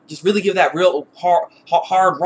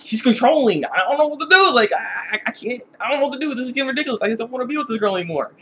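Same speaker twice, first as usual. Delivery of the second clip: higher-pitched, mild mock whining; whiny complaint; 7 semitones higher than usual. Bolin wants out with Eska, higher helpless whine. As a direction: fret in a lightly put-on helpless voice. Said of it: "'cause he's just like".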